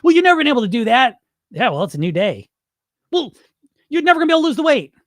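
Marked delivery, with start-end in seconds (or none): 0.0-1.2 high, loud, fast put-down; pitched-up scoffing critic; negative inner voice. Retold or imitated. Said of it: "negative voice in your head". 3.1-4.9 very high, loud, rushed nagging; shrill-pitched repeat of the inner critic; negative inner voice. Retold or imitated. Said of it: "negative voice in your head".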